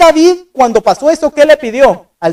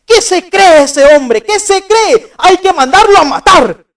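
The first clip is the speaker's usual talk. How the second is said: indignant, higher, louder rant; furious outburst; 7 semitones higher, 4 dB louder than usual. Retold or imitated. King David, outraged at the prophet's story, angry and loud. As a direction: bristle with outrage at the rich man. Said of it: "Y David enojadísimo le dice".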